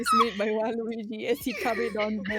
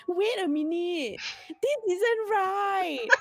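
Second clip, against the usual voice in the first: Melvin's voice; 8 semitones higher, 4 dB louder than usual. Acted. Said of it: "you must imitate his voice".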